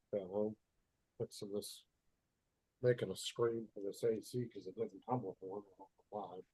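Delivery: far from the mic, muffled and faint. Real, not acted. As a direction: speak from across the room, muffled. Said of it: "You sound muffled".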